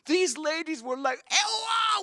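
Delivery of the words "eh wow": high, amazed yelp; imitated excitement. Women reacting to astonishing news, excited high cry. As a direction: cry out in astonishment, much higher.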